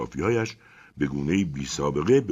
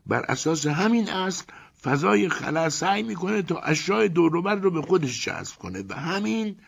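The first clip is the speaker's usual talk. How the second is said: higher voice for Ivan, chatty; Ivan's voice; 12 semitones higher than usual. A narrator's reading. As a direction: lift the voice to play Ivan. Narrator voices Ivan again.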